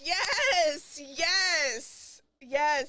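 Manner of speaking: high, loud jubilant yes; joyful yeses. Real, not acted. Streamer cheering the verdict with her caller, jubilant.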